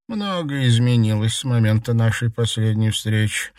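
ordinary delivery, nothing marked

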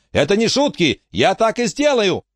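high, urgent insistence; shouted threat. A narrator's reading. Emma warning the captors, high shouted threat. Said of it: "крикнула Эмма".

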